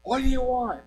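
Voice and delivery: high, sharp call from the porch; mock yell. Lady at door calling to girls, high mock yell. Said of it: "the lady came to the door and said".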